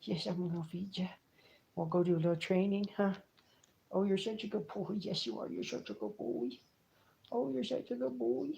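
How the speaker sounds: soft, doting praise for a pet; cooing pet talk. Real, not acted. Owner praises his licking mink, soft cooing.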